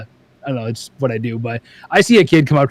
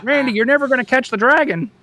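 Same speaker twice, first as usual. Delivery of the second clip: mocking voice; 9 semitones higher than usual. Imitating someone mocking him.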